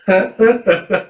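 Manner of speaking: high, hammed-up flirty laugh; character laugh.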